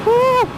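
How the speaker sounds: high, loud "woo"; excited whoop. Real, not acted. Rider cheering as the bike takes off, excited whoop. Motorcyclist cheering while speeding up.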